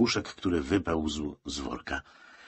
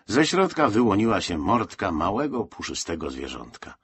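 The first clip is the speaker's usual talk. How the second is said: usual speaking voice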